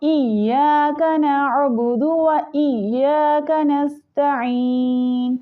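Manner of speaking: slow melodic tajweed chant; Quranic recitation. Shown as a demonstration. Teacher demonstrating the recitation to students, slow melodic chant. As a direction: draw each word out in a measured chant.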